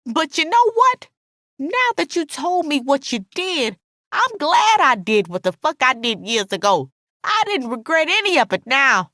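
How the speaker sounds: high, defiant confession; angry, unrepentant friend; spiteful Alice. A narrator's reading. Narrator voices spiteful Alice.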